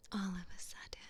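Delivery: ordinary delivery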